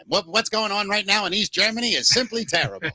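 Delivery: hammed-up vintage newsreel announcer; mock period news bulletin delivery; old-time newscaster. Host reports on East Germany, old-time newscaster voice. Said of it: "that time period when everybody talked like this".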